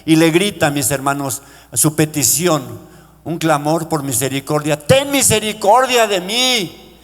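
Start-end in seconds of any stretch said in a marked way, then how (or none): 4.8-7.0 begging shout to Jesus; pleading cry. Retold or imitated. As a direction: drag out the cry for mercy. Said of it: "le gritaba a Jesús".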